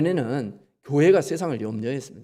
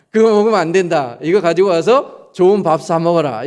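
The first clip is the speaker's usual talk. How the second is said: louder, firm fatherly scolding; father lecturing; 7 dB louder than usual. Retold or imitated. Father tells child not to eat ramen, firm loud lecture. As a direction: lay down the rule to the kid, loud. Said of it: "이렇게 얘기하죠".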